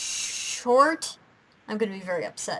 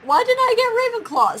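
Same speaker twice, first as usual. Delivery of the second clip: indignant whine; 12 semitones higher, 6 dB louder than usual. Retold or imitated. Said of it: "I'm like".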